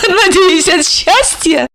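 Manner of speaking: высоко, смеясь; спросить сквозь смех; со смехом. Acted. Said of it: "рассмеялась она". (Ольга со смехом поддразнивает)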